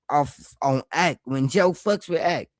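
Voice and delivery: usual speaking voice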